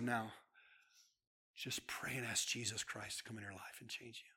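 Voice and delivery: soft unvoiced whisper; whispered urging to pray; whispered prayer guide. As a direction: hush him gently into prayer. Guiding caller to pray.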